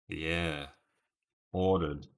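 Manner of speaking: ordinary delivery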